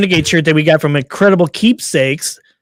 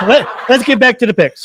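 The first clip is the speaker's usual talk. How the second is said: laughing; 6 semitones higher than usual. Real, not acted. Still laughing after outburst.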